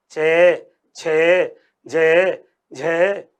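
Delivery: चे, छे drawn out slow and low; low, slow teacher demo of चे छे जे झे; affricate demo. Shown as a demonstration. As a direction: sound out चे छे जे झे slowly in a low voice. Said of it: "मैं बोल रहा हूं".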